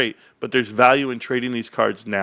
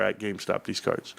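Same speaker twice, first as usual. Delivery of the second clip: whisper; 3 semitones lower, 9 dB quieter than usual. Acted. Whispering the secret to viewers.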